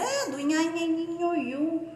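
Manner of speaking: nhanhanhinhoiu with the nh pressed through the nose; teacher drilling the nh sound. Shown as a demonstration. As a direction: chant nhanhanhinhoiu as a nasal drill. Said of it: "O N H".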